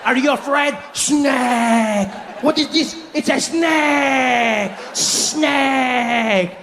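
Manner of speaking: put-on high French-accented jeering with long drawn-out words; mocking French accent. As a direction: taunt slowly as a gleeful Frenchman. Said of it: "And he's like".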